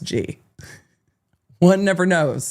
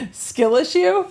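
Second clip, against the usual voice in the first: mock outrage; 13 semitones higher, slower than usual. Real, not acted.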